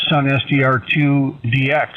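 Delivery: ordinary talk, nothing marked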